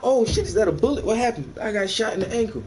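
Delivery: high, fast panicky yelp; mock panic. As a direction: squeal like you think you got shot. Mock panic at imagined gunshot.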